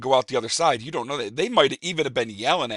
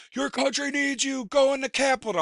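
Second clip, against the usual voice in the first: bullhorn rally call; 4 dB louder than usual. Retold or imitated. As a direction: rouse the crowd like a man on a bullhorn. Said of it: "a dude in the tower on a bullhorn going".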